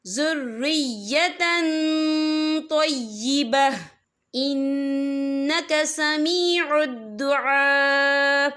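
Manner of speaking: slow, drawn-out tajweed chant; Quran recitation. Shown as a demonstration. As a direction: recite it slowly and melodiously. (धीमी तिलावत)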